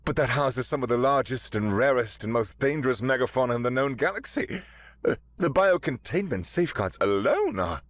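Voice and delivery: academic excitement. A narrator's reading. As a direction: gush over the rare creatures. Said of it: "eyes wide with academic excitement".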